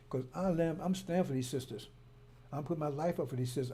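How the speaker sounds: ordinary talk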